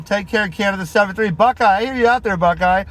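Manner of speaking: usual speaking voice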